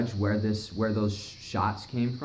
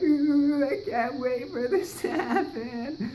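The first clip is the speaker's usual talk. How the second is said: high, slow, gushing mock glee; sarcastic high-pitched anticipation; mock excitement; much slower than usual. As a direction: coo it like giddy kids waiting for a treat. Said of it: "we're just sitting there like".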